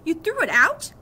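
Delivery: loud, high outraged question; angry disbelief. A narrator's reading. Boy learning dad threw out his helmet, angry. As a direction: hurl it back in disbelief. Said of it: "saw how mad I was".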